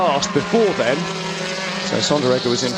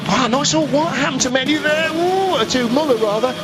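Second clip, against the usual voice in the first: higher, rising excitement over the battle; excited race call; 7 semitones higher than usual. Real, not acted. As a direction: call the wheel-to-wheel fight breathlessly. Commentator calls close battle.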